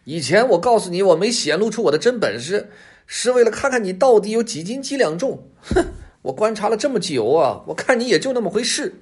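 high, loud, haughty fox voice; boastful fox sizing up a rival; bluffing fox. A narrator's reading. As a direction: talk down to the tiger, pitched high. Fox keeps bluffing tiger.

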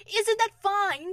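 slow, high, loud call from above; delighted shout to someone below. A narrator's reading. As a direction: call it down proudly, drawn out. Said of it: "shouted Winnie the Pooh down".

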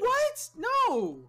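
very high startled what. Real, not acted. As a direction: yelp out the denial. Startled denial.

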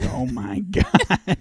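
slow drawn-out gasp of shock; mock shock. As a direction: stretch it out in disbelief. Bin Laden surprised by seals.

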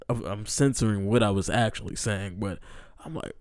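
normal talking voice

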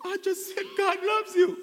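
high-pitched, taken-aback reply; startled protest. Acted. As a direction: squeak out a bewildered defence. Startled reply to screaming Sister Miggy.

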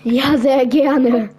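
loud, slow hammed-up thank-you; exaggerated gladly. Real, not acted. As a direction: overplay the delight, stretching each word. Accepting offered item.

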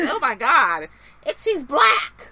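high, slow, loud gasp of shock; mock shocked worker. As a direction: act stunned at the window.

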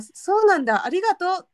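imitating a Kansai guy suddenly speaking prim standard Japanese, high; standard Tokyo speech. Junior colleague thanking his peer, imitated in standard Tokyo speech. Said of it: "わざと関西弁強めで喋ってる".

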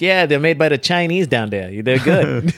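put-on slow Boston accent; a slow hammy Boston local. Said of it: "You're gonna talk like that".

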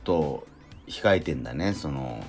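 normal talking voice

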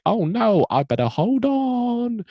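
high, loud, drawn-out mock cry; mock alarm. Rose dangling from a blimp in the Blitz, hammed-up alarm. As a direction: wail it high and stretched, hammed-up.

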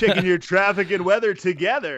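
mock a phony radio jock; super fake voice. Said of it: "the super fake voice".

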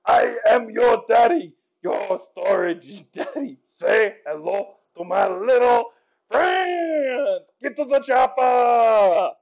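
loud Austrian action-hero shout; hammed-up catchphrases with a thick accent; Arnold. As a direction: drag out the words and bellow. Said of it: "here is Arnold".

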